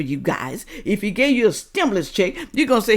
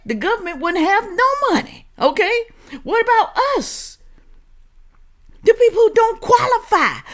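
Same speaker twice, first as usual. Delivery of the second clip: high, worked-up rant, louder at times; heated rant; 4 dB louder than usual. Real, not acted. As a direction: get fired up and preach it high. Ranting at viewers about taxes.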